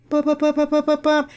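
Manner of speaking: high, monotone run of electronic beeps; rapid, evenly pitched "ba" beeps; imitated phone ping. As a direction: mimic repeated phone pings on one high note. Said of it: "a little ping. It'll go like".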